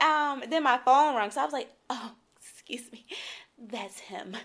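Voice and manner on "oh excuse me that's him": retold whisper. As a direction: whisper it like a half-secret brush-off. Whispering as she fakes a call.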